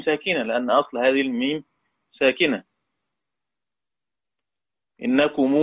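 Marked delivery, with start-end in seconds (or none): none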